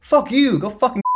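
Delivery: imitating your own angry outburst; mock furious retort. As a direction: spit it back in outrage. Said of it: "I'm like".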